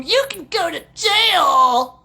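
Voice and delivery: slow hammed-up jail threat; mock cop threat. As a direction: drag out the threat like a smug officer. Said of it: "cop reply in story".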